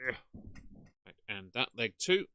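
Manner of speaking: ordinary talk, nothing marked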